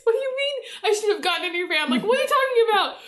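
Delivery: shrieking in disbelief between laughs; baffled laughing disbelief. Retold or imitated. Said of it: "I'm like".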